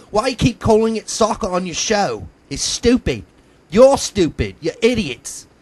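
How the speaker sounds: put-on high, indignant English accent; mock English accent. Host imitating English fans' soccer complaints, high mock English accent. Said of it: "let me do that in an English accent".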